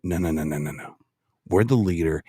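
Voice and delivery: deep tough-guy voice; low, dismissive rumble; Dirty Harry. Shown as a demonstration. Demonstrating the candidate's answer on world government, low tough voice. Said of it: "I sound like Dirty Harry".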